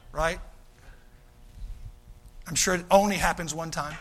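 ordinary talk

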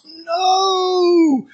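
high, drawn-out mock-indignant no; protesting denial. Peter denying he is one of the crowd, high protesting wail. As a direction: stretch it out way up high. Peter denies a second time.

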